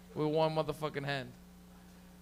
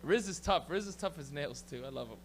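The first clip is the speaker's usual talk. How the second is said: ordinary delivery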